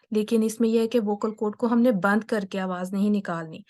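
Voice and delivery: ordinary delivery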